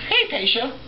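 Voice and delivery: high cooing pet voice; talking to a pet. Real, not acted. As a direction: coo up high at the cat. Calling to his cat.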